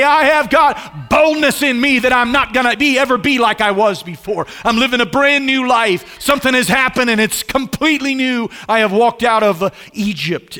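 loud, high, bold proclamation; bold testimony. Pretend. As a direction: shout it out like a freed believer. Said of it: "and they say".

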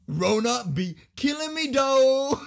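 loud, high, drawn-out mock quote; mocking reply. Mocking a girl's silly reply, loudly. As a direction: belt it out, stretching the last word. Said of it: "she responds by saying".

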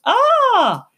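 high loud frightened yelp; startled cry. Acted. Boots shrieking at the rat's hiss. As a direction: shriek like a scared little child. Toddler fleeing the rat.

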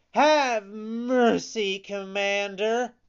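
high, loud begging; pleading. A narrator's reading. Pinocchio trying another title on the showman, high pleading. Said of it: "Then he pleaded".

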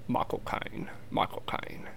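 very soft British accent try-out; quiet, tentative British film-star accent; Michael Caine. Shown as a demonstration. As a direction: test a British accent under the breath. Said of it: "I tried to do a Michael Caine impersonation".

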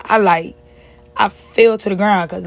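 usual speaking voice

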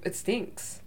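voiceless whispered complaint. Retold or imitated. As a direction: whisper it like a secret. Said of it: "I was like".

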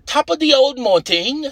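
high, hammed-up mock-British greeting; amateur put-on British voice; attempted British accent. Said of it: "speak like you guys".

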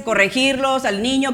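ordinary talk

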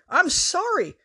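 sorry jumps up high, pleading; a pleading high-pitched sorry; high squeaky sorry. A narrator's reading. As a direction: squeal the apology. Apologising while being hit.

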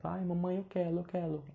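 put-on high babyish voice, R swapped for L; "quero" said as "quelo", cutesy child. Shown as a demonstration. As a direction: whine like a little kid asking mom. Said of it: "desse jeito fofinho".

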